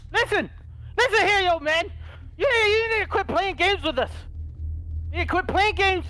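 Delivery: angry scolding. Acted. Confronting old man in game.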